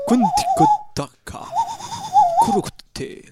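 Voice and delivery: very high, slow squawking gibberish; duck voice. Pretend. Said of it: "c'est des Indiens qui parlent".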